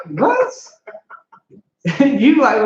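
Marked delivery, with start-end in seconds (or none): 0.1-0.5 high squeaky disbelieving what; a sudden high yelp of disbelief; shocked what. Retold or imitated. Shocked reaction to the greeting.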